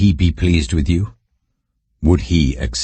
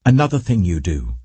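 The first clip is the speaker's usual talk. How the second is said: higher, pressing reading voice; raised scripture reading; 6 semitones higher than usual. Acted. Reader voicing the prophet's rebuke to Judah, higher and pressing.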